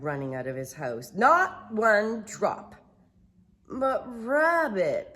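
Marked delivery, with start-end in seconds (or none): 1.1-2.7 stern slow refusal. Acted. Rabbit forbids. 3.7-5.1 moaning. Acted. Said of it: "Pooh moaned".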